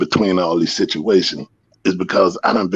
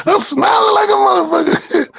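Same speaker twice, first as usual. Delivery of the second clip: high, delighted laughter breaking through; cackling as he recalls winning; laughing. Real, not acted. Telling friends how the other dog quit, laughing.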